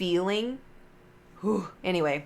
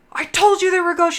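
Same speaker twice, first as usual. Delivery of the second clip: much higher and louder, frantic; retold panicked friend; 10 semitones higher, 8 dB louder than usual. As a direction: friend terrified and vindicated. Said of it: "she freaked the fuck out".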